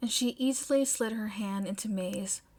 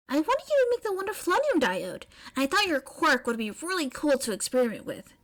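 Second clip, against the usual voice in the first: eager May; 6 semitones higher, 4 dB louder, faster than usual. Acted.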